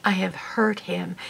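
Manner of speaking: real tears, the words barely whispered; a real tearful whisper. Real, not acted. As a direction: whisper it, choking up with guilt. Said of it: "forgive me if I cry".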